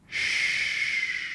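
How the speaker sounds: "sh" as a long, steady hush; sustained sh sound. Shown as a demonstration. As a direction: hold "sh" with no vowel after it. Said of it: "that's the sh in Arabic".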